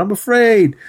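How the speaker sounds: high-pitched whiny imitation; hammed-up scaredy-cat whine; mock cowardly whine. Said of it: "he's just pussying out".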